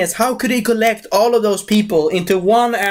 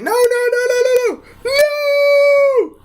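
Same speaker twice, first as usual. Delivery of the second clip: hammed-up terror. Threatened character refusing his fate, mock-terrified wail. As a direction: wail it in a squeaky falsetto.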